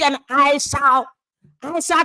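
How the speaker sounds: "shall" drawn out slowly and weighed; stress on shall. Shown as a demonstration. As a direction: lean hard and slow on "shall", like an oath. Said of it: "kyerɛ sɛ by force".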